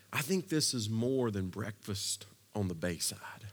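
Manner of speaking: ordinary delivery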